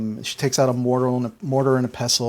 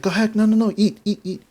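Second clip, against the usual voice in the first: high, louder, repeated urging; insistent urging; 9 semitones higher, 4 dB louder, faster than usual. Acted. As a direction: press food on a guest as Gram. Gram urges guest to eat.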